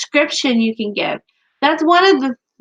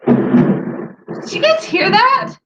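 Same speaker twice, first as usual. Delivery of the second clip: sudden high-pitched, drawn-out surprise; startled aside; 13 semitones higher, 3 dB louder, much slower than usual. Real, not acted. Panelist checks whether the others heard a noise, startled. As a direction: break off in real surprise, voice jumping way up.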